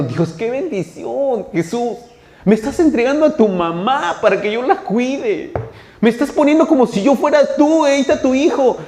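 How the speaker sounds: high, loud cry of blessing; overwhelmed gratitude. Acted. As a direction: exclaim it like someone amazed to be entrusted. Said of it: "mientras uno estaba diciendo". (gratitud exaltada, voz aguda)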